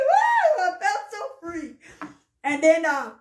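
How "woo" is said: loud, high-pitched woo; high holler of freedom; joyful whoop. Real, not acted. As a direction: whoop with real release. Joy at feeling free.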